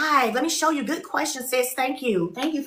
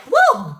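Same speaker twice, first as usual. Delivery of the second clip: cheer; 3 semitones higher, 6 dB louder than usual. Real, not acted. Cheering the goal.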